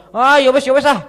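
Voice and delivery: high, loud mother huffing off in a sulk; sulky huff. Retold or imitated. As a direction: brush him off in a sulky huff. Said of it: "ngambek dia". (ngambek, nada tinggi dan keras)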